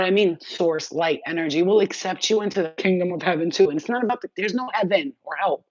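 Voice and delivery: ordinary talk, nothing marked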